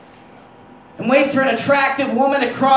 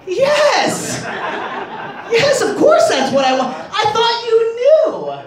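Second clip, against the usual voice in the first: laughing confession; 10 semitones higher, slower than usual. Acted. His guilty answer to wife.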